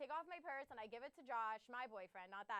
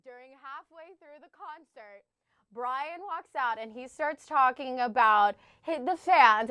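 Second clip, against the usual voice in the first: ordinary delivery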